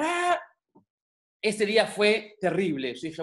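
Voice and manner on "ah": high-pitched mock yell; short, high pretend shriek; imitated scream. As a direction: mimic the neighbour starting to scream, high. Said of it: "y empezó a gritar".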